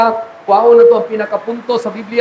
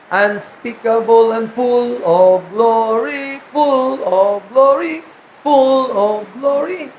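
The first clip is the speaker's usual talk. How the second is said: slow savoring; slower than usual. Acted. Preacher savoring repeated phrase.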